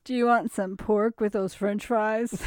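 put-on mocking voice of cohost; mock your cohost's way of talking; teasing imitation, played for laughs; mocking impression. Shown as a demonstration. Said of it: "the voice when you mock me".

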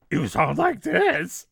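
slow, high talking-animal voice; puppet voice. Retold or imitated. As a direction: imitate a puppet talking, drawn out. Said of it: "an animal talk or a puppet".

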